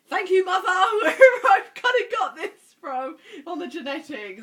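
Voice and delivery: loud, high laughing gratitude; laughing thanks. Real, not acted. Thanking her mother for her genes, laughing loudly. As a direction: laugh it out as you thank mum.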